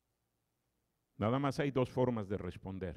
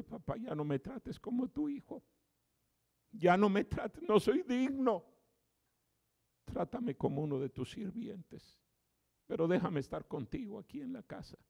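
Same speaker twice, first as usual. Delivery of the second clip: higher voice, humbly begging; ashamed, pleading son; 9 semitones higher than usual. Acted. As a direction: plead for a place as a servant. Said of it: "avergonzado, humillado, llorando".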